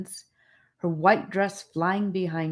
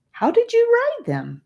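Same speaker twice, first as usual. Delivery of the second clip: put-on curious boy; 6 dB louder than usual. A narrator's reading. Charlie asks about frog riding.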